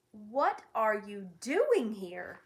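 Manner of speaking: slow growling dog voice; growled. Acted. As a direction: growl it out slowly. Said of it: "growled the watchdog".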